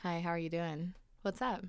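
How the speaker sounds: low-key greeting. Retold or imitated. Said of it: "couldn't be that person and be like".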